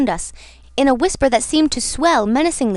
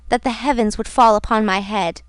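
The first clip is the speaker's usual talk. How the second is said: ordinary delivery, nothing marked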